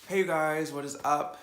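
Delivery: bright, loud greeting to viewers; hype intro. Real, not acted. Vlogger greeting his viewers, loud and hyped. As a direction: pump up the channel hello.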